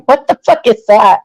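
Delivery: ordinary talk, nothing marked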